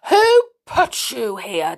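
demanding. A narrator's reading. Missus Stick to her freed son, demanding. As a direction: insist on knowing, word by word. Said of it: "demanded Missus Stick".

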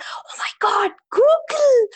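lightly voiced mom, stunned then thrilled; mom's amazed gasp. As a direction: start hushed, then explode with joy. Mom reads the acceptance email.

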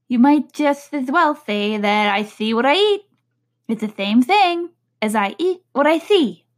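high, odd Hatter voice. Acted. The Hatter contradicting Alice in a high odd voice. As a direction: pitch it up, scolding.

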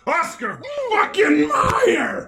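very high, slow, drawn-out yell; excited shout. Real, not acted. Host yelling the brand after the jingle, very high and slow. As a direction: belt the brand name like a fan.

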